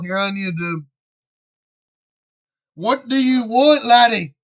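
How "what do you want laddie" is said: put-on Scottish accent. Gamer answering someone mid-recording, light Scottish accent. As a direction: snap it at them like a grumpy Scot, Scottish accent. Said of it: "Why'd I go Scottish?".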